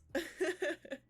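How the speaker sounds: short high giggle; high little laugh. Real, not acted. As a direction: break into a quick high-pitched giggle. Laughing at the made-up words.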